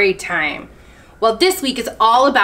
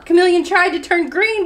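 usual speaking voice

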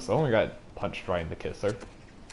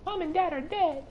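put-on childlike voice; 4 dB quieter than usual. Voicing child in game scene.

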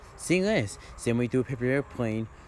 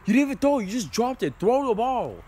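annoyed yell; 7 semitones higher, 5 dB louder than usual. Acted. Scolding a friend who dropped the ball.

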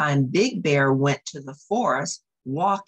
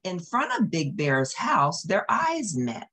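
usual speaking voice

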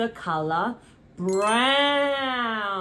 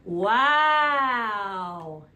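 ordinary talk

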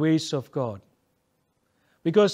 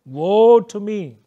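crying out; 3 semitones higher, 7 dB louder, slower than usual. Retold or imitated. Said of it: "I cried".

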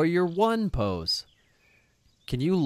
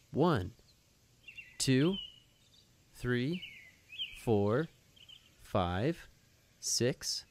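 put-on teaching a child to count; 4 semitones lower, 6 dB quieter, slower than usual. Counting aloud for kids' yoga.

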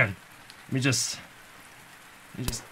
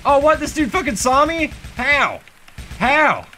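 can't believe he got spotted; shocked disbelief; 9 semitones higher than usual. Real, not acted. Gamer spotted by a guard, yelping in shock.

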